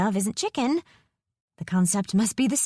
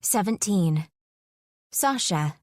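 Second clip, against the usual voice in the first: plain reading voice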